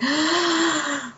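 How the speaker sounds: long, high drawn-in gasp; awed gasp. Shown as a demonstration. Demonstrating being glued to alien shows, awed gasp. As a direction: gasp as if completely zoned out. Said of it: "just like that".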